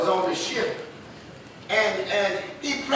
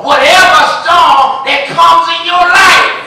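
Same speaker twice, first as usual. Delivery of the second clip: full preaching shout; 2 semitones higher, 8 dB louder than usual. Real, not acted. Preacher shouting God's power to the congregation. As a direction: preach it at the top of your voice.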